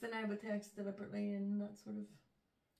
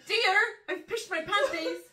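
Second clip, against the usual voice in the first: mock confession; 11 semitones higher, 14 dB louder than usual. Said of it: "if they said".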